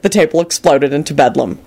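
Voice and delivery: ordinary delivery, nothing marked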